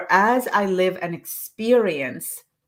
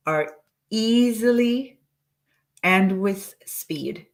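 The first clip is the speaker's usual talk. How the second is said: slow, drawn-out dictation; 2 semitones higher, slower than usual. Shown as a demonstration. Coach slowly dictating an affirmation to the audience. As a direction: draw out each word for writers. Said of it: "say it again slowly".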